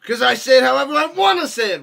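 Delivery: loud, fast and defiant; a playful shout of defiance; defiant outburst. Real, not acted. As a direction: blurt it out, daring anyone to correct you. Defiant outburst about the name.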